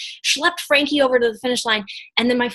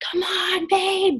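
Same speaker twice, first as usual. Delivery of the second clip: high, bright cheer for her husband; excited cheering; 9 semitones higher, much slower than usual. Retold or imitated. Wife cheering husband at the final miles, high excited call. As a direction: cheer him on as he rounds the corner. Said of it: "jumping up and down, cheering for him".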